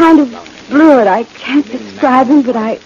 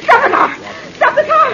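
high-pitched, frantic cry to stop; panicked; 13 semitones higher than usual. Acted. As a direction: cry out in terror to halt him. Panics, orders him to stop.

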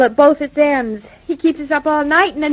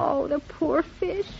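mock lament; 10 dB quieter, much slower than usual. Lamenting the eaten goldfish.

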